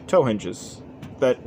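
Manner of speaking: normal talking voice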